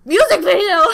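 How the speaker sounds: loud, high disbelieving "music video?"; shocked question. Retold or imitated. Told to watch the video, loud and surprised. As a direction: yelp it in sudden surprise.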